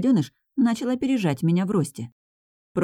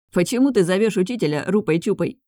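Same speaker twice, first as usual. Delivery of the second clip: ordinary delivery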